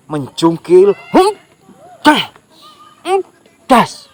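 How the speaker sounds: straining grunts. Acted. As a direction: grunt and heave as if prying something loose. Grasshopper prying out the stick.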